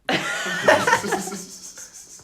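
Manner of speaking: high, wheezing burst of laughter; loud laugh. Real, not acted. Player cracking up over the stolen boat joke, loud high laugh. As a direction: crack up loudly and long.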